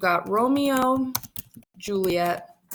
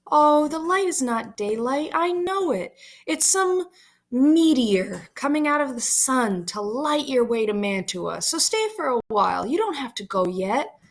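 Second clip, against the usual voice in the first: higher, swooping pitch, coaxing; pleading Juliet; 5 semitones higher than usual. Acted. Juliet insists it is not daylight, pleading. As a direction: coax him with a high, lilting plea. Juliet answers Romeo, begging stay.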